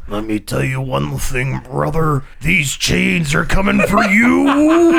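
low gruff wrestler promo voice; wrestler impression. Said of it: "it's so good".

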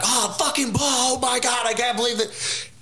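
exaggerated frantic exclaiming; mock freak-out. What the carjacked driver didn't do, mock frantic outburst. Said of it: "He doesn't even be like".

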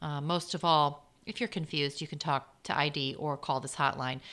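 normal talking voice

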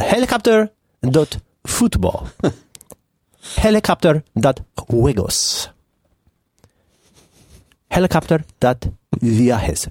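put-on slow, careful Spanish-accented drill; mock Spanish teacher, each word spaced out. Host leading a Spanish class through domain names, mock Spanish accent.

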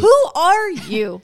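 slow, loud, high mock-outraged question; exasperated demand. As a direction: drag it out in comic despair. Exasperated plea to unknown listeners.

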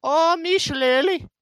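high, loud, slow stage Irish "me shillelagh"; hammed-up Irish accent. Pal clowning, crooning "me shillelagh" in a mock Irish accent. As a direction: croon it like a cartoon leprechaun. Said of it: "trying to come out with this Irish accent".

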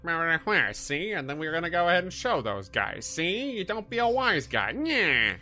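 high, loud wise-guy gangster; 1930s gangster voice. Retold or imitated. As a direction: bark it like an old-movie mobster. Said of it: "you're pretty sure it would sound like".

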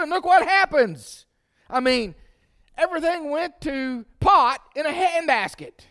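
loud, high, driving sermon voice; full-force preaching. Acted. Preacher warning the church about bitterness, loud and high. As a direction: preach it hard to the congregation.

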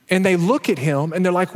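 ordinary delivery

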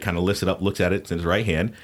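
ordinary delivery, nothing marked

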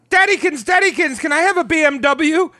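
put-on high whiny begging child voice; spoiled whiny kid. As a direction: beg daddy like a spoiled brat. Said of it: "Gandhi's like".

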